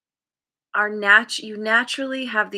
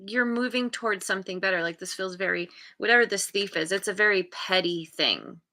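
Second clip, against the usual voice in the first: ordinary delivery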